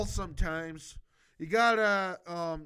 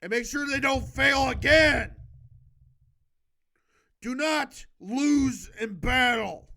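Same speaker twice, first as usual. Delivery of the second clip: loud, forceful order to the troops; commanding shout; 5 semitones higher, 7 dB louder than usual. Acted. Reader barking orders at imaginary generals, loud shout. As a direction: bark it like a drill sergeant.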